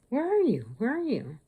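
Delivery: high-pitched, sing-out searching question; calling out, searching. Retold or imitated. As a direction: call out high, looking for someone. Retold searching for unseen speaker.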